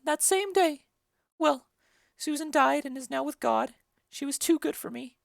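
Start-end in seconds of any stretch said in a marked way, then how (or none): none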